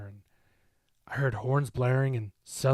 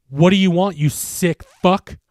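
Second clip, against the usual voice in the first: high, loud enraged yell, slow at the end; shout; 7 semitones higher, 14 dB louder than usual. A narrator's reading. Mark yells at the creature, furious shout. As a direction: scream defiance at the monster. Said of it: "I shouted at it".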